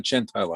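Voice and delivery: Gentile said as JEN-tile; unwanted mispronunciation. Shown as a demonstration. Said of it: "as long as it's not".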